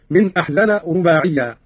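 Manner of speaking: ordinary delivery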